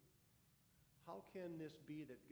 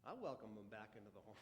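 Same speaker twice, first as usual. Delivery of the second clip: bitter muttered echo; 8 semitones lower, 6 dB quieter, faster than usual. Acted.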